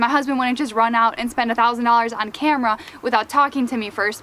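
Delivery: ordinary talk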